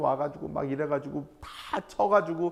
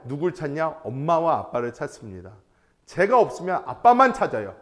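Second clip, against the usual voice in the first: ordinary talk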